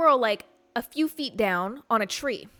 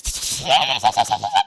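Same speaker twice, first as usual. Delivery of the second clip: loud, rapid squirrel chatter; squirrel noise imitation; 12 semitones lower, 7 dB louder than usual. Showing listeners the squirrel's noise, loud chattering imitation. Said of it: "is making that noise".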